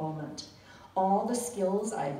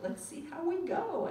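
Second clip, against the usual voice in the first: stiff phrasebook tourist; 9 semitones higher than usual. Retold or imitated. Phrasebook Italian farewell.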